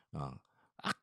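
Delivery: ordinary delivery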